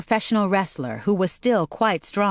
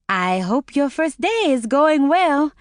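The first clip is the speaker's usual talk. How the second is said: friendly receptionist; 9 semitones higher, 3 dB louder than usual. A narrator's reading. Voices Peggy.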